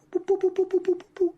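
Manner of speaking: very high-pitched repeated boops; little cartoon power-ring sound; squeaky, evenly repeated boop effect; imitated ring noise.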